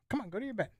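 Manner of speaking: talking to a dog. Real, not acted.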